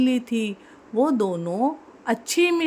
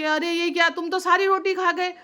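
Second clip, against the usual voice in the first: pleading cat; 6 semitones higher, faster than usual. Acted. Cat pleads with greedy monkey.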